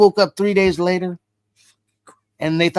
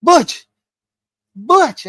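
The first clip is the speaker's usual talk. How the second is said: high, loud call to wake him; retold yell at the dazed uncle; 10 semitones higher, 8 dB louder, slower than usual. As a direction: shout his name to rouse him. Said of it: "And I start yelling".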